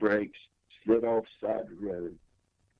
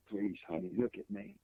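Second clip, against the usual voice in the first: much softer, imitated plea from the wife; soft pleading; 11 dB quieter than usual. Husband imitating his wife begging him to look, softly. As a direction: coax him gently, as she begged.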